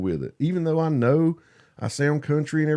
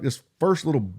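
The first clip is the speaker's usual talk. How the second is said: ordinary talk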